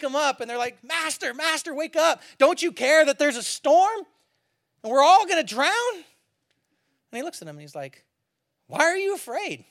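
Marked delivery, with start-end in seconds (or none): 0.8-5.9 higher-pitched acted alarm; urgent pleading. Disciples waking Jesus in a storm, urgent pleading. 8.7-9.6 mock bafflement. Woken Jesus asks, baffled.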